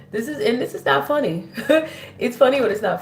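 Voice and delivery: giggling through the words; laughing. Real, not acted. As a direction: laugh while you say it. Laughing at harsh card message.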